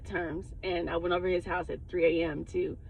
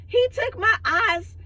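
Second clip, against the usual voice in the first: crackhead lady voice; 13 semitones higher, 4 dB louder, slower than usual. Retold or imitated. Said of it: "this crackhead lady came in".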